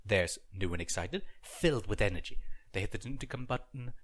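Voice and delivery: ordinary delivery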